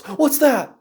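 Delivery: high, urgent imitation; alarmed. Re-enacting the guy who silenced everyone, alarmed. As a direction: blurt it in sudden alarm. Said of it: "As soon as the guy goes".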